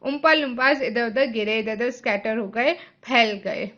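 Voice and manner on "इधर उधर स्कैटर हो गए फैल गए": ordinary delivery, nothing marked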